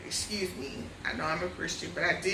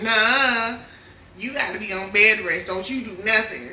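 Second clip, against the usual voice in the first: louder, insistent husband telling her no; firm order; 3 semitones higher, 9 dB louder than usual. Retold or imitated. Protective husband loudly insisting she stay in bed. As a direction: lay down the law, husband style.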